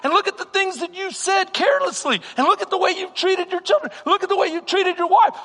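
high, mocking accuser's taunts; accusing enemy voice. The enemy taunts the pastor with his failures, high and accusing. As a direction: needle him with every failure. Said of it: "He says".

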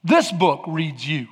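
slowed, weighted delivery; slow and solemn. Acted. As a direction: let each phrase land slowly. Drops to slow reflective tone.

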